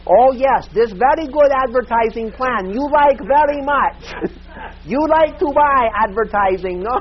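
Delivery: high, loud broken-English sales pitch; pushy saleswoman overdoing a Korean client's accent. Retold or imitated. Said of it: "this is how the salesperson was talking".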